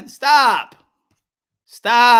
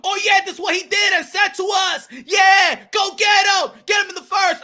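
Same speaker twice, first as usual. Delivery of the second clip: hyped-up corner man; 8 semitones higher than usual. Retold or imitated. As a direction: mimic a corner hyping a fighter. You don't be like.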